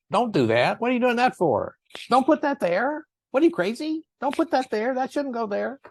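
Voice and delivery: high, shrill-pitched mock scolding; scolding family. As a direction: nag like the parents who punished with words. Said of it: "verbally punished anybody".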